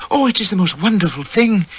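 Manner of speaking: put-on high, enchanted young woman's voice; marvelling wife. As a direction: gush over the honour. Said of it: "she kept saying".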